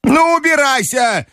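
high, slow dismissal; high-pitched angry old lady; shouting grandmother. A narrator's reading. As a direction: send him packing in a high, cross voice. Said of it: "крикнула бабушка".

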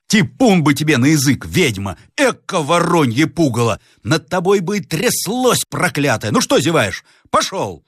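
high-pitched angry servant's tirade; indignant cursing. Acted. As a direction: curse her out, then order the driver on. Servant curses madwoman, orders driver. (слуга бранится высоким голосом)

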